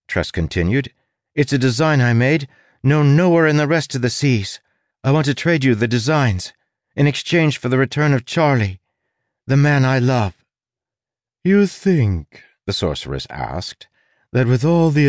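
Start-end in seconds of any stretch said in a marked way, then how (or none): 1.3-10.3 put-on earnest, higher young woman's plea; pleading young woman. Tress pleads with sorceress. 11.4-12.4 high, sneering sorceress voice; scornful sorceress. Acted. Sorceress mocks Tress.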